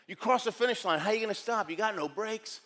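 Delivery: ordinary talk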